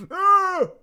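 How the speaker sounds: high, loud mock cry; short yelp. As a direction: yelp as if hit. Mock victim after chat agrees.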